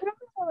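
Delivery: high, small half-asleep dog noise; imitated sleepy bark. Host imitating Jake's worst barks, high mock yelp. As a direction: mimic a dog's silly half-asleep bark. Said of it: "like the worst barks".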